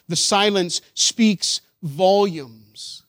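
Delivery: loud, drawn-out words with pauses; weighty pulpit pronouncement; slow, emphatic preaching. Real, not acted. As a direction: let each word ring out slowly. Preacher drives home a point.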